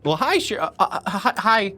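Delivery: high, stammering, nervous greeting; flustered receptionist. Acted. Young receptionist startled by the sheriff barging in, high flustered stammer. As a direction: trip over the words like a startled young clerk. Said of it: "the receptionist goes".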